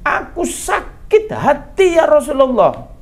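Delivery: high, drawn-out acted wail; anguished cry. Mother confessing her hurt to the Prophet, anguished cry.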